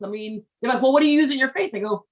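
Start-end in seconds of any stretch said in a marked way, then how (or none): 0.8-1.7 higher, louder mock disbelief; incredulous relative. Relatives grilling her about soap, incredulous higher louder. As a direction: act out a horrified relative's question.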